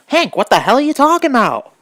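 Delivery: high, loud and fast, what on earth; blurting out total confusion at the book; baffled outburst. Real, not acted. Reader baffled by the narrator's odd phrase, loud outburst.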